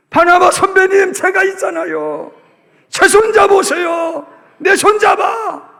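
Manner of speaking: loud, high call to take a hand; encouraging shout. Acted. Paul loudly urging his senior Barnabas to take his hand. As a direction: reach out loudly, slowing at the end.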